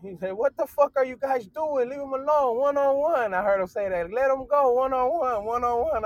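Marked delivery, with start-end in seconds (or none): 0.3-3.3 loud, high-pitched intervening yell; imitated bystander shouting. Acts out bystander calling out. 4.1-6.0 loud, high-pitched intervening yell; imitated bystander shouting. Acts out bystander calling out.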